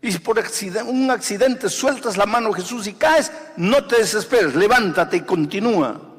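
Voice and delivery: predicación a todo pulmón. Acted. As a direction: urge them on at full force. (predicando con fuerza, más alto y fuerte)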